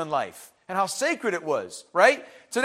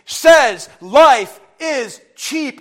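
very slow and louder, life stretched; louder, drawn-out preaching refrain; emphatic refrain; 3 semitones higher, 9 dB louder, much slower than usual. Real, not acted. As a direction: drive the refrain home.

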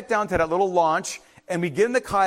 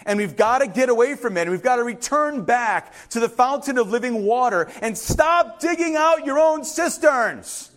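yelling; 5 semitones higher, 3 dB louder than usual. Real, not acted. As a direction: yell the call back to the fountain. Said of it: "Yes, I'm yelling".

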